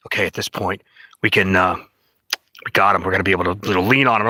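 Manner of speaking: low, hushed plotting among bosses; lightly voiced low scheming huddle. Bosses deciding to lean on him, lightly voiced conspiring.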